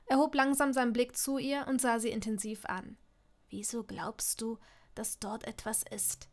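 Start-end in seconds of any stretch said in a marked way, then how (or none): 3.5-6.3 slow, very soft question; guarded and quiet. A narrator's reading. Draco is asked about his arm, quietly guarded. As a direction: measure each word, giving nothing away.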